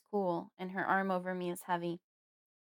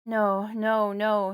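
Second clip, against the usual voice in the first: much louder, anguished moaning no; 9 dB louder, much slower than usual. A narrator's reading. Grieving father rocking his dead wife, moaning. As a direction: moan it out in grief. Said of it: "he moans".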